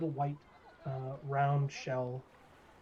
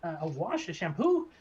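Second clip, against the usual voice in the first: barber gnome; 6 semitones higher, 4 dB louder than usual. Acted. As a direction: coo over her hair like a gnome barber. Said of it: "He says".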